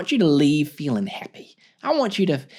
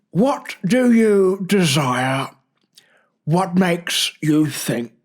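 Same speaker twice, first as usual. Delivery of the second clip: slow, deliberate nature-documentary voice; unhurried Attenborough-style reading; slow, hushed documentary narrator reading philosophy; David Attenborough impression; slower than usual. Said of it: "I'm doing David again".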